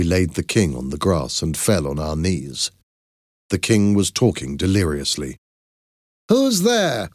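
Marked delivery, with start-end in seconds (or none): 6.3-7.1 sharp high call into the dark; a startled high cry of challenge; cried out. A narrator's reading. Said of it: "cried a voice".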